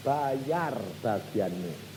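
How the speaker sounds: ordinary talk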